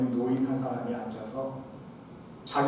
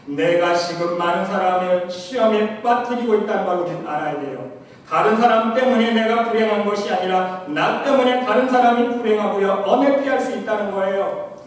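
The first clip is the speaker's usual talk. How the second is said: loud, high ringing preacher's cry; sermon climax, voice raised high and loud; full-force preaching; 4 semitones higher, 7 dB louder than usual. Acted. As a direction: lift the congregation toward heaven.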